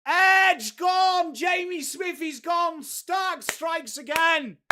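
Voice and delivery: roaring commentary-style celebration; excited wicket shout. Real, not acted. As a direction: scream it as the wicket falls. Wicket falls live.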